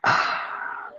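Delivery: soft breathy sigh of giving in; resigned sigh. Real, not acted. A teacher gives in to the boss's request with a soft sigh. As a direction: let the air out, giving up. Agreeing to stay on.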